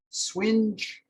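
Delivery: Swinge said with a W sound at the start, sw-inj; dialect word swinge. Shown as a demonstration. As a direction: say Swinge not singe, with a W. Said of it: "it's swinge with a W".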